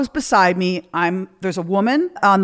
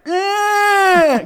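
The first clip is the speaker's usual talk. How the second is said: high, loud muffled eh through a Lego; Frankenstein groan; 13 semitones higher, 4 dB louder than usual. Retold or imitated. Her son with a Lego stuck in his mouth, loud high groan. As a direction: mimic a kid groaning with his mouth stuffed. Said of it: "He's like Frankenstein".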